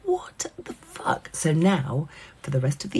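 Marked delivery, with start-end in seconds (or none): 0.0-1.3 whispered disbelief, drawn out; slow, breathless whispered what the fuck; stunned whisper. Retold or imitated. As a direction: mouth it in shock to yourself. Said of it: "just going".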